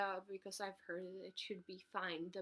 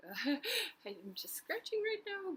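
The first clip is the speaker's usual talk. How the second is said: laughing; 9 semitones higher than usual. Real, not acted. Laughs while scratching on camera.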